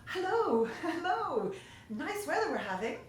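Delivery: high, loud friendly call. Acted. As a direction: hail him from up the hill. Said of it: "Moon Boy saw him coming and called".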